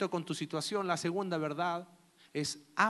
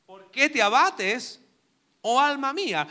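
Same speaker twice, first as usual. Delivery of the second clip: slow reading; 5 semitones higher, 7 dB louder than usual. Shown as a demonstration.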